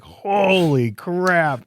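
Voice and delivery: drawn-out amazed exclamation; retold awe. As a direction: let the awe stretch out. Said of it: "And it's like".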